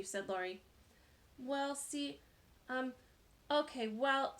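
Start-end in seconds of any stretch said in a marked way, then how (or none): 1.4-4.4 halting, flustered stammer with pauses. A narrator's reading. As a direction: trip over the words nervously. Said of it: "stammered Colleen".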